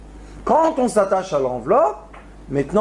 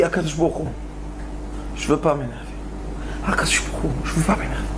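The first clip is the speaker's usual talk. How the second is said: low, soft, half-whispered prayer; hushed pleading to God; quiet plea; 5 semitones lower, 6 dB quieter, much faster than usual. Retold or imitated. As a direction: murmur it to yourself, holding back. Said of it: "Et il crie".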